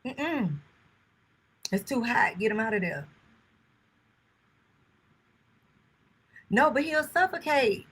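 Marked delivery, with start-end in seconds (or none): 1.7-7.9 raised voice, higher and louder; yelling across the room. Real, not acted. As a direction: call it out to the next room. Telling son to get dog out.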